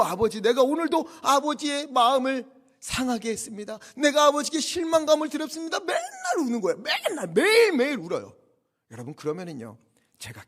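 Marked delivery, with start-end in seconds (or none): 0.0-5.8 high, loud imagined lament; mock tearful daughter. Imagined young daughter apologizes to her father, mock tearful wail. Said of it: "맨날 울어요". 5.8-8.3 mock exasperation. Father complains his imagined daughters cry daily, mock exasperated wail.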